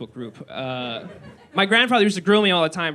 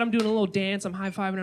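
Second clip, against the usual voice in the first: ordinary talk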